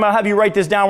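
ordinary talk, nothing marked

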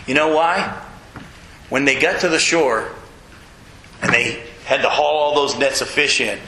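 normal talking voice

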